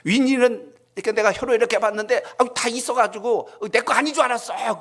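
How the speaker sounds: very high, flustered lady's voice; older woman explaining herself. Retold or imitated. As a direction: imitate an embarrassed woman, high and animated. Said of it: "그러면서 이야기하시는 걸". (높은 목소리로 변명하는 아주머니 흉내)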